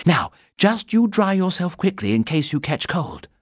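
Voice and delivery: high, fussing big-sister tone; motherly girl voice. Acted. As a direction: fuss over him like a mother. Judy fussing over Paddington.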